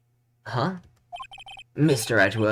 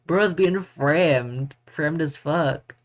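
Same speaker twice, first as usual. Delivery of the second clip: slow drawn-out; 3 semitones higher, much slower than usual. Real, not acted. Drawn-out exclamation.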